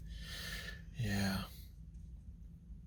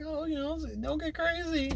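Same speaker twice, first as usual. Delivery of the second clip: much higher and softer; high soft mock girl voice; 8 dB quieter than usual. Woman teasing the nervous boy, high soft mock voice. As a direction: lift it up high and hush it. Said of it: "She's like".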